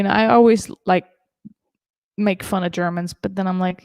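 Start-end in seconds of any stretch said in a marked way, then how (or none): none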